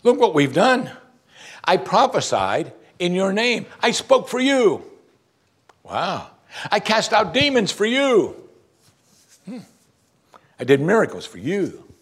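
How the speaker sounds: high, proud bragging; self-important churchgoers listing their deeds to Jesus, high and boastful; boastful believers. Acted. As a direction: brag to Jesus about every deed, voice lifted high. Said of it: "Notice what they said to him".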